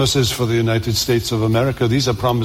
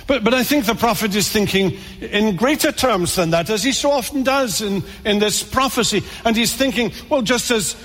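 higher and higher, preaching at full force; fervent preaching; 4 semitones higher than usual. Acted. Pastor urging his congregation with mounting fervour. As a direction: build the sermon toward its peak.

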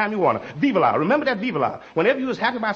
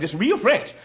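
ordinary talk

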